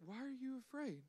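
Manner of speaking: Jesus quietly questions his frightened disciples; calm hush. Retold or imitated. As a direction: ask it softly, unbothered by the storm. Jesus wakes calmly in the storm.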